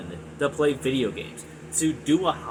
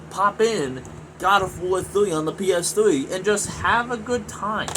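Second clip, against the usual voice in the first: frustrated rant; 6 semitones higher than usual. Real, not acted. Gamer ranting about gaming as chore.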